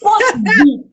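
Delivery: high-pitched retold disbelief; exclaiming to oneself. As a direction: act out baffled self-talk, pitched well up. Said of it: "I'll be talking to myself".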